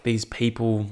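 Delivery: ordinary talk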